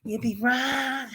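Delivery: ordinary delivery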